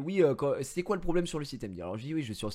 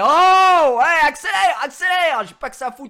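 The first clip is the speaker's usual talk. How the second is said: impatient yell; 12 semitones higher, 17 dB louder than usual. Retold or imitated. Yelling at slow agent on phone.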